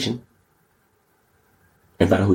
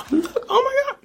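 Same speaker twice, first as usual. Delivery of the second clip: gasp, then a much higher, hammed-up Look, Oh my God; mock shock. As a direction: play up fake astonishment.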